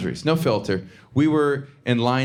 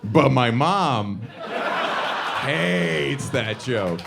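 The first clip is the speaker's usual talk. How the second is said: drawn out very slowly, leaning on mom and hates; slow emphatic punchline; much slower than usual. Acted. Comic telling the crowd about his mom, slow and weighted. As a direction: land each word with a heavy pause.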